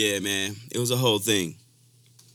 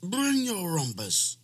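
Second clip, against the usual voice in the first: very high, loud holler, then slow; hammed-up high call, stretched out slowly; hammed-up scream; 4 dB louder, much slower than usual. Replaying his yell at the dog for laughs, high holler. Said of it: "why you sound like Ronald Reagan".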